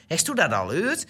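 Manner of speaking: mother asking in Gronings with formal u, "Heeft u dat al gehoord" as "hest u dat al heurd". Retold or imitated. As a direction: imitate mother speaking Gronings to the older sister. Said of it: "tegen mijn broer en ik werd Nederlands gesproken".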